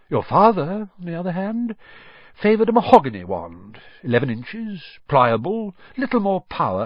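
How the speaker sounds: a hint of a strange old shopkeeper savouring memories; eerie old wandmaker. Old wandmaker recalls the mother's wand, lightly voiced and dreamy.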